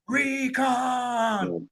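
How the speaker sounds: high, long-held movie yell; drawn-out yell. Retold or imitated. Guy in a war movie answering a whisper in his ear, yelling. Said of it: "and the guy yells".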